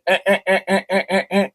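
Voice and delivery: high repeated crow squawks; crow alarm call. Retold or imitated. Crow back on the limb warning its owner, imitated squawking. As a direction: imitate a crow's insistent short calls. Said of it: "and she said".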